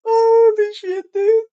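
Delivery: stunned holy shit; shocked. Real, not acted. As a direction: gasp it out in shock. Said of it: "reaction to story".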